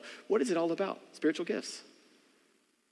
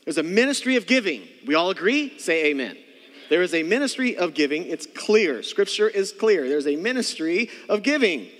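louder, rising preacher's chant; preaching refrain; 3 semitones higher, 5 dB louder than usual. Acted. Pastor pressing the congregation to agree, loud preaching. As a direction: drive it home to the room.

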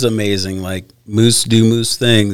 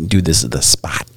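whispered, low and secret; hushed whisper; 7 semitones lower, 3 dB quieter than usual. Retold or imitated. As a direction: whisper it like game is near. Whispering to buddies while hunting.

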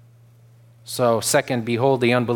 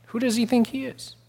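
higher, mocking scribe voice; scornful scribe; 10 semitones higher, 5 dB quieter than usual. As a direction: sneer it like an offended scribe. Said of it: "It says".

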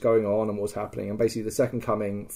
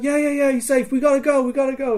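high, raised-voice brush-off; imitated urgent hurrying; 13 semitones higher, 3 dB louder than usual. Friend hurries the player away, high urgent imitation. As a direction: hustle them along insistently.

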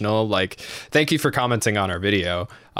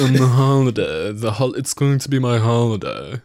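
pompous mock Oxford accent; exaggerated British toff impression. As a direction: play a pretentious upper-crust Brit. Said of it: "the Oxford accent".

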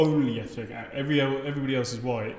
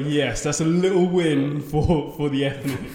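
mock triumph; 2 semitones higher, 7 dB louder, slower than usual. Triumphant retold thought.

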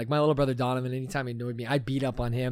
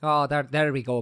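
normal talking voice

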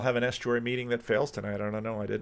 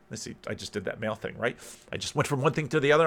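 quick self-interrupting aside; rapid-fire commentary on oneself; talking real fast; much faster than usual. Real, not acted. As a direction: rattle it off in a hurry. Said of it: "I'm talking real fast".

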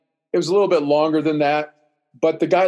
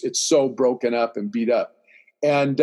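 ordinary talk

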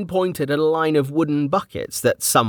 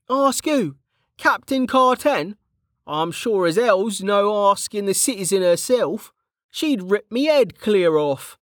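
higher, rough-edged friendly soldier; Kettle's voice; 10 semitones higher than usual. A narrator's reading. Kettle greeting an old friend back from the dead, jovial. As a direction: play the cheeky old comrade, teasing.

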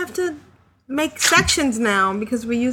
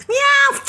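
cat meow; 14 semitones higher, 5 dB louder than usual. Shown as a demonstration. After bat squeaks, cat noise.

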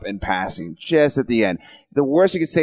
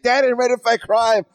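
put-on excited child; 11 semitones higher than usual. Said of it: "It's like".

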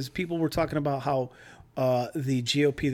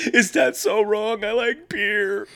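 mock Kavanaugh; 6 semitones higher, slower than usual. Mocking Kavanaugh's hearing.

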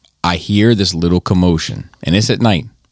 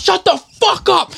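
high-pitched re-enacted shout; retold angry yell. Husband shouting down a stranger in the street, re-enacted yelling. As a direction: yell back, acting out the fight. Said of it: "I'm yelling at this dude".

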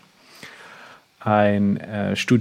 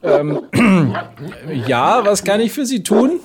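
fake innocent; 8 semitones higher than usual. Sketch: answering police innocently.

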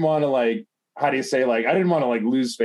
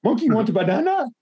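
much higher, teasing sing-song monkey voice; mocking monkey taunt; 10 semitones higher than usual. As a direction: taunt your buddy like he's a monkey. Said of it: "be like".

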